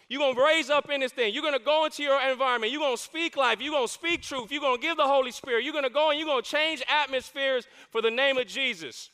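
ordinary talk

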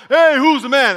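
imitated holler down the mall, high and very loud; yelling from afar. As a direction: shout it out to someone far away. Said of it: "they'll yell from way down the mall".

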